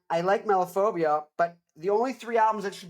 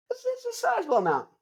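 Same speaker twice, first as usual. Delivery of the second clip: mock squeaky boast, much higher; faster than usual. Showing off his arm to viewers in a squeaky voice. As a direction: squeak it out while flexing.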